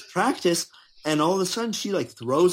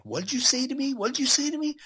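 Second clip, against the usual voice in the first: high-pitched indignant challenge, acted out; much higher voice, pressing the question; mock confrontation; 13 semitones higher than usual. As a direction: imitate someone demanding an answer. Confronting teenagers who swore at them.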